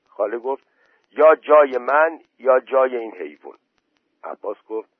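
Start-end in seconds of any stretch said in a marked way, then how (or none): 1.1-3.6 louder, higher, put-out aunt; cross ultimatum. A narrator's reading. Aunt gives Abbas a choice over the dog, crossly. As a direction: lay down a mildly angry ultimatum. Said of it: "اوقات تلخی نکن خاله".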